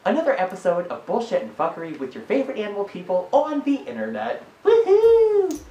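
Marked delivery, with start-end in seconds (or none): 4.6-5.6 high loud woohoo; excited cheer. Real, not acted. Cheering the show intro.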